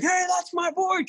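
a high, imitated shout of hey that's my board; hollering at the thieves, half-playing it; mock yell. Retelling how he'd yell at the thieves, a high mock shout. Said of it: "I'm not gonna yell at them and be like".

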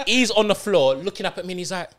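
ordinary talk

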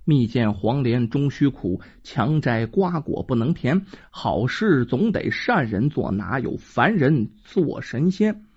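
measured, low storyteller's verse; poem recitation. Shown as a demonstration. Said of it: "说这么几句定场诗啊".

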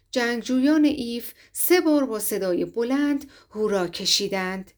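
plain reading voice

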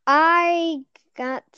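ordinary talk, nothing marked

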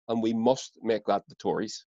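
normal talking voice

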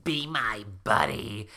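slow, drawn-out ghostly plea; creepy ghost. A narrator's reading. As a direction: stretch it out eerily, slowly.

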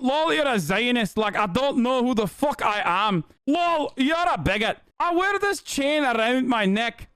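high mock Scottish accent; hammed-up Scottish lilt, voice pitched well up; Eve Fartlow, Scottish accent. Streamer mocking a columnist's boastful tweet, high Scottish accent. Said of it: "my regular Eve Fartlow impression".

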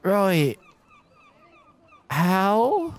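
lightly voiced nervous Kiwi young man; a hint of a New Zealand accent, unsure. GM as Gavin meeting strangers, in a light Kiwi accent. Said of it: "that accent has gone".

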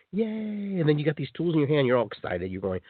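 usual speaking voice

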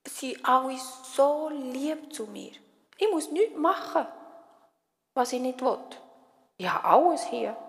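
lightly voiced child bunny; Josi the bunny. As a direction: voice a small rabbit listing his comforts. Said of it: "denkt der Josi".

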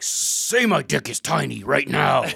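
loud, slow hammed-up demand; mock tough boyfriend. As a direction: put on a drawn-out macho challenge. Acts out the angry boyfriend.

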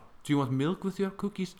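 high, sweet hostess offering milk. Acted. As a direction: play a sugary host asking. Acting out a host offering.

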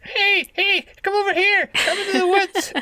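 very high, squeaky, loud squirrel voice; magic squirrel voice. Pretend. Host voicing the magic squirrel luring into the woods, squeaky high. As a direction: lure them in as a squeaky woodland critter. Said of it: "if that was the voice".

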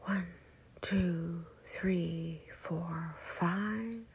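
soft measured count, lifting on five; inhale count. Shown as a demonstration. Guide counting five seconds of breathing in, soft count with final lift. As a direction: count gently, rising at the top of the breath.

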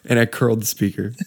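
normal talking voice

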